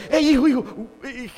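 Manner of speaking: high, urgent babble; mock scandalised panic; flustered pastor. Pastor running from the cursing lady, flustered.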